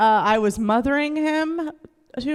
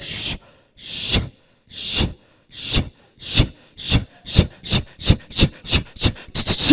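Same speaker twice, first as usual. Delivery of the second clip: imitated brush strokes. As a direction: run of short hushing swishes. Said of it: "it starts out, it's like".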